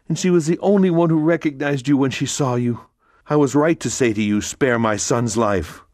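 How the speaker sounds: Mercedes pleading. Acted. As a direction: plead as the distraught mother.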